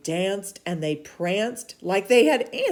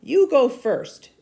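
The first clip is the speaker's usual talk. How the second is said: louder, slow and higher; bold challenge; 8 semitones higher, 11 dB louder, slower than usual. Acted. Elijah daring the false prophets, loud and slow. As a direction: dare them to go first.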